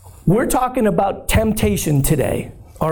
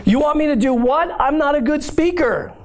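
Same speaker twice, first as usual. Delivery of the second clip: higher, disbelieving protest; incredulous Moses; 7 semitones higher than usual. Retold or imitated. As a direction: Moses can't believe what he's asked. Moses balks at God's command.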